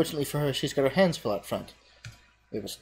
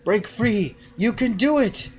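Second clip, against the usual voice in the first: much higher, pleading; melodious voice; 8 semitones higher, 5 dB louder, slower than usual. Acted. Fiola to the hero, high melodious plea. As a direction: lift him toward freedom.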